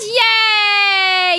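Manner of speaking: long, drawn-out high yay; excited cheer. Real, not acted. Cheering on a fellow streamer, excited. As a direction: cheer it out and hold it.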